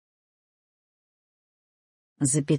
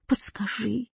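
soft, pleading request; 2 semitones higher, 9 dB quieter than usual. A narrator's reading. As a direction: beg quietly. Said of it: "умолял Кузя". (тихая мольба)

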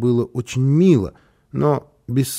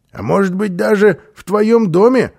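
high, louder, rising hopeful question; bright-eyed hopefulness, pitched up; 8 semitones higher, 6 dB louder than usual. A narrator's reading. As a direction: ask with eager hope in the voice. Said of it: "с надеждой в голосе".

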